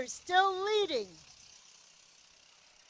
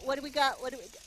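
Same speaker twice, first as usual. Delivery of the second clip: ordinary delivery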